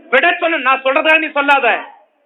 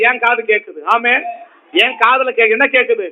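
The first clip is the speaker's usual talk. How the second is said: usual speaking voice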